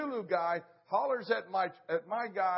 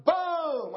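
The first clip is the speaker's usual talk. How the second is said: gunshot boom; 11 semitones higher, 8 dB louder than usual. Retold or imitated. Imitating the final shot.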